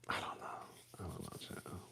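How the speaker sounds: barely audible whisper to oneself; whispered aside. Real, not acted. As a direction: trail into a whisper, undecided. Undecided, muttering to herself about going live.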